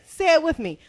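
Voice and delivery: usual speaking voice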